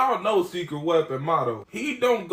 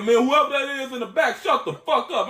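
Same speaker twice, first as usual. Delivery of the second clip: high, raised mock-irate voice; loud angry yell; 7 semitones higher, 3 dB louder than usual. College Boy silencing the friend in the back, loud mock anger. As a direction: imitate an angry man yelling at someone in the background. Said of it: "He said loud as hell".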